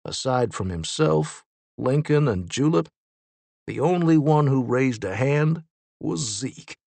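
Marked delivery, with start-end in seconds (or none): none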